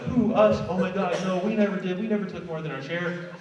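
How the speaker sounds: high, mock-indignant denial; defensive. Back-row oppressors confronted by the pawns, mock-defensive and high. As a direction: play the innocent oppressor, hammed-up. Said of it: "They're all defensive".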